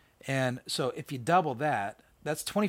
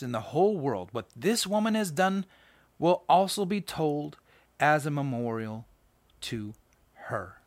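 scripture reading; 6 semitones higher than usual. Acted. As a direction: read the passage out with weight. Reads scripture aloud.

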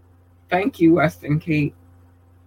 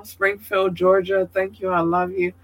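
usual speaking voice

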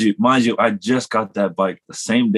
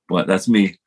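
ordinary talk, nothing marked

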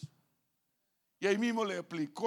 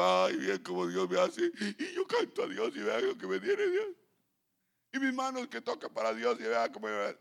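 mock self-pity. Pastor mocking a self-pitying believer, mock whining. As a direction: mimic a man moaning in his cave. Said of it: "metido llorando".

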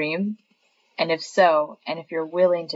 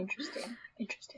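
whispering; 4 semitones higher, 20 dB quieter, faster than usual. Acted. Mock intrigue at secret clue.

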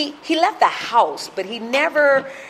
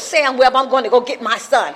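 louder, higher, insistent mother; imitating defiant mother; 5 semitones higher, 6 dB louder than usual. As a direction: stand up to the husband as mother. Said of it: "And she goes".

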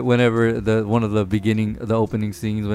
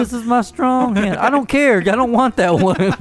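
put-on childish whine; 11 semitones higher, 4 dB louder than usual. Quoting a movie kid, high childish whine. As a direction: pout like a stubborn kid refusing. Kid refusing the other hand.

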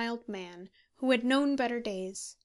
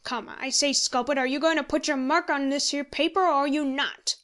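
loud, high, pressing question; forceful, exasperated ultimatum; impatient demand; 4 semitones higher, 6 dB louder than usual. A narrator's reading. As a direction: corner him into answering. Handy demanding a signature.

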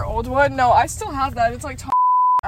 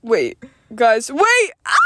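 loud startled wait; alarmed shout; 5 dB louder, slower than usual. Real, not acted. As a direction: shout it as the car hits. Car just hit them.

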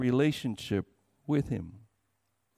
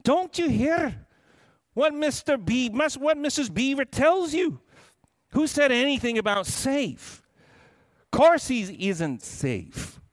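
higher, louder, indignant beaver; Mr Beaver retorting; 8 semitones higher, 3 dB louder than usual. A narrator's reading. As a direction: scold the child for doubting. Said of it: "said Mister Beaver".

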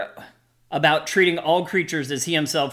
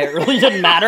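laughing; 6 dB louder than usual. Real, not acted. Laughing about being high.

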